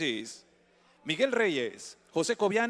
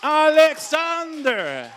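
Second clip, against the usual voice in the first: drawn-out booming name call, much higher; big fighter introduction; 9 semitones higher, 8 dB louder, slower than usual. Real, not acted. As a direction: stretch the name out like a ring announcer. Announcer calls fighter's name.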